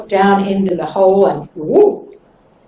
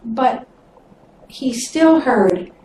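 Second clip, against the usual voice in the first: ordinary delivery, nothing marked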